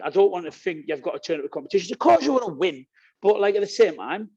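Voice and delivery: usual speaking voice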